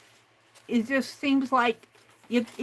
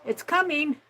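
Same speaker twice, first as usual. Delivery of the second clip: ordinary talk, nothing marked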